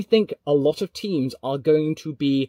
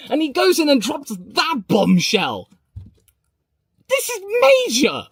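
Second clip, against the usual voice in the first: real excitement, much higher and louder; over the top; 10 semitones higher, 6 dB louder than usual. Real, not acted. Streamer losing it over breaking news, over-the-top excitement. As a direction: whoop and shout it, beside yourself. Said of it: "getting a little bit over the top".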